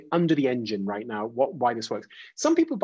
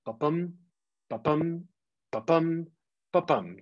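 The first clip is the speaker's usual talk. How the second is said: "ba-bum" like a heartbeat, stress on "bum", a pause after each; heartbeat rhythm; 3 semitones higher than usual. Shown as a demonstration. Teacher shows how iambs sound like a heartbeat, heartbeat rhythm on "ba-bum". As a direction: repeat "ba-bum" as evenly spaced short-LONG pulses. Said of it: "sound like a heartbeat".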